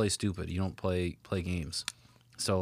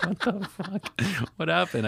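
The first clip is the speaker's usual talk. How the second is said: laughing disbelief; 10 semitones higher, 4 dB louder, slower than usual. Retold or imitated. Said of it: "I'm like".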